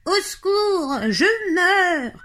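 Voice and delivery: high, wailing fake distress; hammed-up moaning. Jean-Claude pretends to be hurt, moaning theatrically. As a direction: wail for help as if wounded. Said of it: "se met à gémir".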